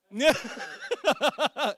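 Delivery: loud high laugh; big amused laugh; burst of laughter. Real, not acted. Audience asked about American Pie.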